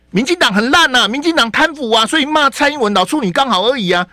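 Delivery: hammed-up fan cheering the insult; mock netizen rant. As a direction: play a worked-up voter yelling slogans. Mocking angry netizen rant.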